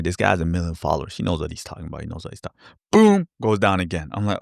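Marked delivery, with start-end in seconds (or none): 0.0-2.6 softer and softer, fast self-soothing mumble; self-reassurance. Retold or imitated. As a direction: talk yourself out of panic, fading away. Talking himself into holding.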